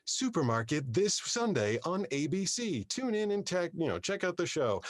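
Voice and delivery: high, sing-song computer voice; synthetic voice inflection. Retold or imitated. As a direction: imitate a text-to-speech voice's lilt. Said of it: "she had this inflection to her voice".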